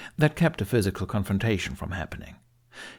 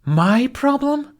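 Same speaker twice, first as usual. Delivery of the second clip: much higher, louder, slow disbelief; stunned outrage; 8 dB louder, much slower than usual. A narrator's reading. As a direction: repeat it, stunned and offended. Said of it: "nearly struck speechless at his audacity".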